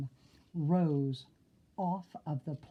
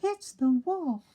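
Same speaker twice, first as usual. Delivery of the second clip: scared pig; 9 semitones higher, 4 dB louder than usual. Acted. Frightened pig warns brothers.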